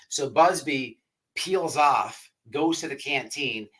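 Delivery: ordinary delivery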